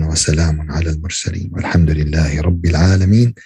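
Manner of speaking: fast, low, run-together Arabic dua recitation; rapid closing prayer. Acted. Preacher closing the sermon, leading the congregation in a fast low Arabic prayer. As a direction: rattle off the closing supplication in one low breath.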